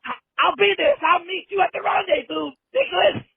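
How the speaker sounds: excited shouting. Acted. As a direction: yell it out like charging in. Caller hypes himself, shouting.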